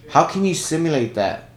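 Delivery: ordinary talk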